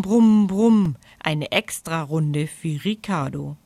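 usual speaking voice

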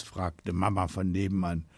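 ordinary delivery, nothing marked

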